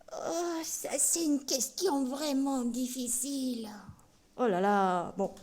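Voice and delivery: the teddy bear stumped by a question; puppet voice. Acted. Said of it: "Ça, c'est Tolo".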